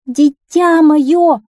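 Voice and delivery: high, loud, drawn-out wail of a mother; long, desperate call; anguished cry. A narrator's reading. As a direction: cry out slowly to a lost child. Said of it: "закричала королева".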